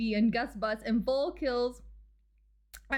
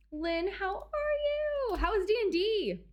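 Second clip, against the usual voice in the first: high excited greeting; 9 semitones higher, slower than usual. Real, not acted. Greeting raider.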